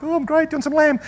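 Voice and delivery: put-on nervous young self. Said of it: "I'm like".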